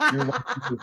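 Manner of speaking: high, soft squeaky echo of you're my hero; mock squeak. Echoing the compliment to Rocky in a high squeaky voice. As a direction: squeak it out like a starstruck fan.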